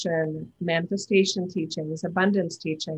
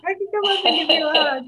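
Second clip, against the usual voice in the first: wishful excited hug; 8 semitones higher, 4 dB louder, faster than usual. Retold or imitated. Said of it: "I wish I was like".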